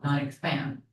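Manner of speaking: ordinary talk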